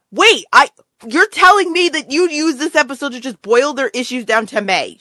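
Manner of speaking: high-pitched, indignant disbelief; exasperated viewer calling out the writers; incredulous outburst. Retold or imitated. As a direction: protest it as if you can't believe your ears. Acting out own disbelief at plot.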